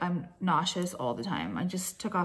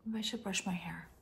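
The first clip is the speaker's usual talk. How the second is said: whisper; 17 dB quieter than usual. Real, not acted. Alone before filming, private mutter.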